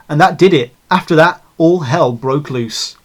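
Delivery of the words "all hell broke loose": ordinary delivery, nothing marked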